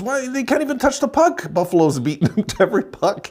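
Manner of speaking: very high mock wail of disbelief; freaking-out buddy. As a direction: imitate a pal's high-pitched panic over the game. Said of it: "he's freaking out".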